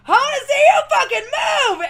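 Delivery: loud, high stage yell; retold shout. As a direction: scream at the crowd from the stage. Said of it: "you hear me go".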